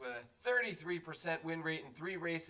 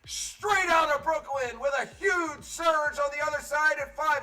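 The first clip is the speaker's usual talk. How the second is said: race call at full force; 11 semitones higher, 11 dB louder than usual. Acted. Announcer calling horses to the finish, building shouted play-by-play. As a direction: drive it higher and louder to the line. Calls the race's stretch run.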